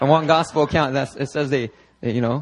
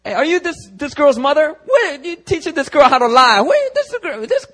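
high, loud indignant sputtering; acted outraged rant; 12 semitones higher, 6 dB louder than usual. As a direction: scold the mother in mock outrage. Peter berates the girl's mother.

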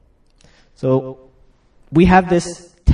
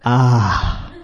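low, contented "ahh"; peaceful sigh; 6 semitones lower than usual. Acted. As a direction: let out a satisfied sigh. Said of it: "And peace, this".

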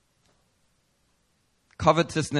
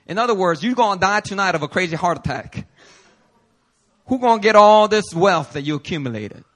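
normal talking voice

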